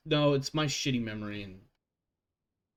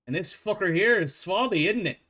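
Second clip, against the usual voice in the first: put-on Scottish accent; 4 semitones higher than usual. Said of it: "a Scottish accent".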